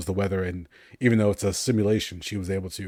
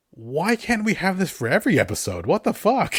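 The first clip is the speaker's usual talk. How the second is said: louder, higher, rising to an exasperated what the fuck; pitched-up excited outburst; excited disbelief; 10 semitones higher, 5 dB louder than usual. Retold or imitated. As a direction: gush like a thrilled fan who can't believe it. Fan gushing over a great episode.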